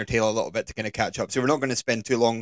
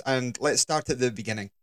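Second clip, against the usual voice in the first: ordinary delivery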